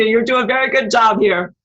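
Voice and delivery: ordinary talk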